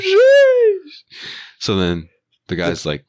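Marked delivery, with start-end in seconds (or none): 0.0-0.9 very high, loud stretched sheesh; hyped sheesh. Retold or imitated. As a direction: squeal it like the crowd just went wild. Reacting to dad's burn.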